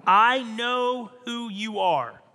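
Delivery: drawn-out cry of recognition; spirit speaking. Acted. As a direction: call it out slowly and loudly. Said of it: "spirit within him that is speaking".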